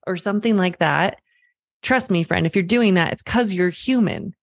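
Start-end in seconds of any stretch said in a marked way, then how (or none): none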